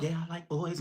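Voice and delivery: lightly voiced mock confession; mock soft voice.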